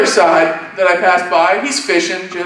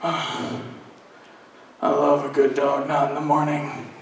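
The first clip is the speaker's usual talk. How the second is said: low, soft mumble; mumbling to himself; 8 semitones lower, 10 dB quieter than usual. Retold or imitated. Tired man at breakfast talking to himself, mumbling. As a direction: mumble it privately, well under your breath. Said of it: "he just kinda mumbles to himself".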